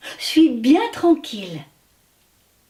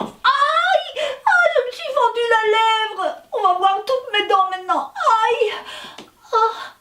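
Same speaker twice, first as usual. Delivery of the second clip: cries of pain; 9 semitones higher than usual. Acted. Wasabu hurting his lip, high pained cries. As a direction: yelp like you just split your lip.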